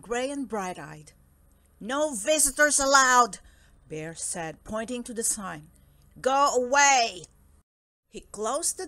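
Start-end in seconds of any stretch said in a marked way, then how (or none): 1.8-3.4 loud, indignant bear laying down the rule; booming, stern refusal; gruff order. Acted. Bear orders mouse away from door. 6.2-7.3 loud, drawn-out order to leave; slow, booming bear command; angry dismissal. Acted. Bear sends the mouse away.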